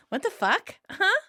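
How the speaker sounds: high, rising mock bewilderment; Phoebe baffled. Woman baffled by guest's inheritance question, bewildered. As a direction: act baffled, pitch climbing sharply. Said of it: "Phoebe is like".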